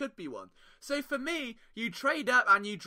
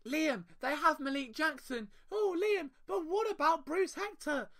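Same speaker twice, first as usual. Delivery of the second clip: higher, mock-whiny objecting fan; imagined objecting listener; 8 semitones higher, 3 dB quieter than usual. Host voicing an imaginary listener protesting his pick, in a higher mock voice. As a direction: play the listener who pushes back. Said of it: "gentle listener, who's just said that in his head".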